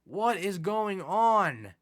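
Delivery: slow, drawn-out despair; drawn-out exasperation. Real, not acted. Gamer despairs at yet another rejection, drawn out. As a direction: stretch it out in disbelief.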